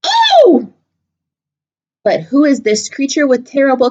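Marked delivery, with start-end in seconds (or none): 0.0-0.7 high, loud shocked "Oh!"; startled gasp. Acted. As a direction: jump with fright on one word. Mouse sees the Gruffalo.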